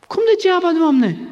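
high-pitched, indignant question; baffled, high-voiced objection; incredulous protest. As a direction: act out Joshua questioning in disbelief. Joshua protests to God.

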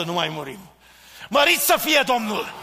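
ordinary talk, nothing marked

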